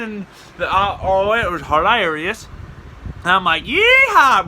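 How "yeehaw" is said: high, loud cowboy holler; whooping. Retold or imitated. Driver taunting the handcuffed cop, gleeful whoop. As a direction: let out a hammy yeehaw.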